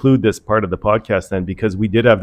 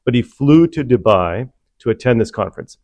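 ordinary talk

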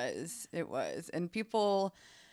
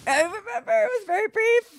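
much higher, squeaky echo; high mock voice; 8 dB louder than usual. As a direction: parrot her words in a high squeak. Mocking sister's line, high voice.

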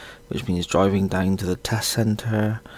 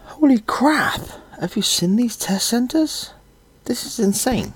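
high-pitched, stunned disbelief; shocked exclamation; 3 dB louder than usual. Real, not acted. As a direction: gasp it out in real shock. Shocked at the test centre.

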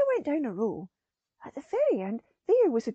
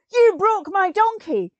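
loud, high and slow, accusing; outraged old man yelling; angry shout; 8 semitones higher, 10 dB louder than usual. Acted. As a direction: scream it at the one who broke it. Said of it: "the old man was shouting and screaming".

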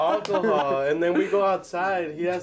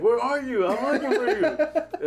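laughing; 8 semitones higher than usual. Retold or imitated. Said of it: "he's like".

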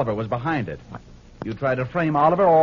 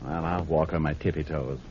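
hushed low voice; soft and quiet; 10 semitones lower, 7 dB quieter than usual. Acted. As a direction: speak softly so as not to wake her. Sleeping woman, speaks softly.

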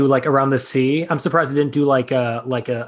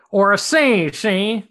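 higher gangster tag 'see?'; old-time gangster voice; 7 semitones higher, much slower than usual. Retold or imitated. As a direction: tack on a mobster 'see?'. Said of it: "one step away from the transatlantic accent".

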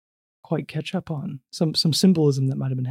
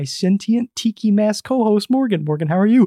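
rising, louder, worked-up greeting; conniptions in the intro; 6 semitones higher, 4 dB louder than usual. Real, not acted. Host introduces co-host Morgan, worked-up. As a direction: build higher and louder into the greeting. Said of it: "several conniptions in the middle of that intro".

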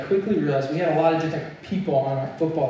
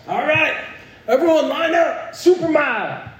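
high, loud coach bellowing orders; coach's yell; 10 semitones higher, 4 dB louder than usual. Retold or imitated. Coach calling his worn-out team back to line up, yelled. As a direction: yell it across the field like a coach. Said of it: "he just yells".

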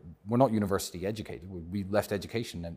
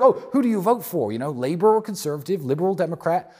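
mock questioner; 9 semitones higher, 7 dB louder than usual. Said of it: "everyone's like".

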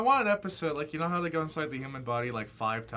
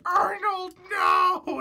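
much higher, louder cry of horror; horrified cry; 5 dB louder, much slower than usual. Retold or imitated. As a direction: cry out in mock horror at the student. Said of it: "watching in horror from the bus".

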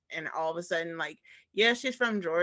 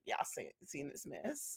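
gossipy whisper; 12 dB quieter than usual. Retold or imitated. Group gossip quote, unvoiced laugh.

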